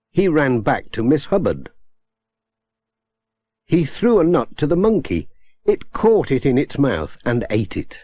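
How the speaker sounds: plain reading voice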